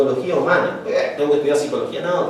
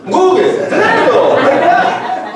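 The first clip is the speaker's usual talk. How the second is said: much higher, laughing and louder; 11 semitones higher, 4 dB louder, much slower than usual. Real, not acted. Teacher laughing at a student's answer, high and amused. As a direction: burst out laughing at the obvious answer.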